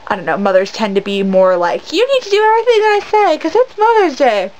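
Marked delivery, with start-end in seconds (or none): 1.9-4.5 high, quick, bossy mom imitation; mock a mom laying down the law; demanding mother. Imitating mothers who expect obedience on Mother's Day, high and demanding.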